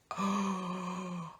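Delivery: sharp soft gasp of shock. Real, not acted. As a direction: gasp in horror.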